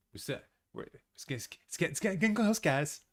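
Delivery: stumbling over words with excitement; tripping over words, giddy; excited stutter. Real, not acted. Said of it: "I'm stuttering a little bit 'cause I'm so excited".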